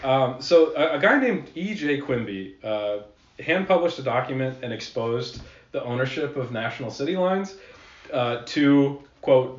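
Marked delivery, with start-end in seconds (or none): none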